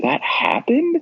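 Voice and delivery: high, disbelieving question; incredulous. Retold or imitated. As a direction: ask it like you can't believe it. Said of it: "you're like".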